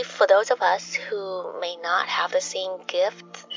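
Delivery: ordinary delivery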